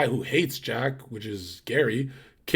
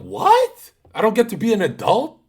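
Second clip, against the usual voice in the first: much higher and louder, squeaky outrage; exasperated disbelief; 9 semitones higher, 8 dB louder than usual. Retold or imitated. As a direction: yelp it like a betrayed kid. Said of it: "I'm like".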